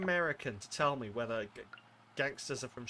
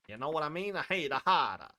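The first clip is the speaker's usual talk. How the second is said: higher-pitched put-on American gangster accent; mock gangster accent; 4 semitones higher, 5 dB louder than usual. Streamer clowning over the word toidles, mock gangster accent. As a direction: ham up an old-time gangster accent. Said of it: "it sounds like gangsters".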